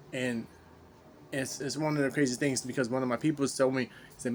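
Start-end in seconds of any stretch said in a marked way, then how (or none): none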